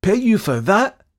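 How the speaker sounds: high, loud incredulous question; outraged cry. A narrator's reading. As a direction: cry it out, rising in disbelief. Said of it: "he cried".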